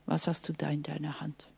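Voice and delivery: low, soft whisper as God; a hushed divine question. Acted. God asks Moses quietly, soft whisper. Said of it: "Und Gott sagt".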